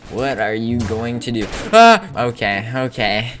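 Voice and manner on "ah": loud high yelp; startled scream. Real, not acted. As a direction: scream out as you're hit. Getting killed.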